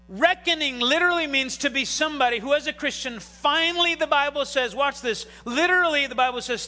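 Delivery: loud, driving sermon delivery; full-force preaching. Acted. Pastor preaching to his church, loud and driving. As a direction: preach it hard to the congregation.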